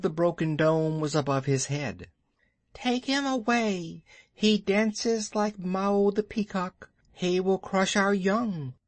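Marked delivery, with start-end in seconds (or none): 2.7-8.7 high, thin snake complaint; cobra voices. Acted. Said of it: "said the cobras inside".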